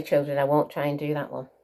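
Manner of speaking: ordinary delivery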